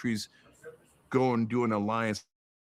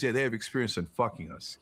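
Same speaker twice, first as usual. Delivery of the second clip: ordinary talk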